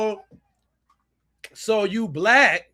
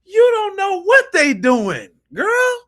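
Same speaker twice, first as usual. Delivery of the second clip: indignant retort; 14 semitones higher, 7 dB louder than usual. Real, not acted. Arguing back at clip.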